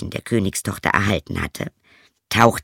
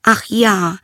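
high, louder princess voice; storybook princess, pitched way up; princess character; 11 semitones higher, 5 dB louder than usual. A narrator's reading. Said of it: "sagte sie".

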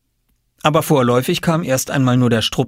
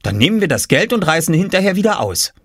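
put-on higher sly child voice; scheming; 9 semitones higher, faster than usual. A narrator's reading. Oskar plotting with Lars, sly. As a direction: plot it gleefully.

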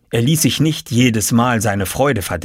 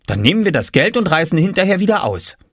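put-on high conspiratorial child voice; lightly voiced sly little boy; scheming boy; 9 semitones higher than usual. A narrator's reading. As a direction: hatch a cheeky plan. Oskar plotting with Lars.

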